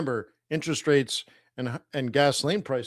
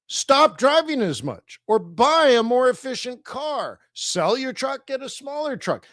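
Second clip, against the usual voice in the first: a touch of a preachy adviser, pitched higher; higher, drilling the advice like a lecture; lecturing capitalist; 9 semitones higher than usual. Retold or imitated. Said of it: "closer to Wayne would say".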